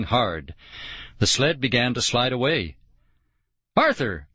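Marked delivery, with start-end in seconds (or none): 3.7-4.3 high, strained call after him; narrated scream. A narrator's reading. Kid calls after the departing sled, screaming. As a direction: cry it out high without full volume. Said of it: "I screamed".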